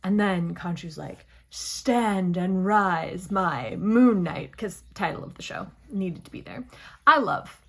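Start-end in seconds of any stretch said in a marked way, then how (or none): 1.5-4.6 very slow, loud, grand god's command; Khonshu. Retold or imitated. God summoning his dying avatar, mock-grand proclamation. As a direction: proclaim it like a deity, drawing out each word.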